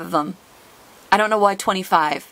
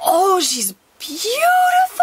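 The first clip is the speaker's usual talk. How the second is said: high, slow swooning praise; breathless adoring exclamation; awed delight; 12 semitones higher, much slower than usual. Real, not acted. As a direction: melt over the card. Rare card revealed.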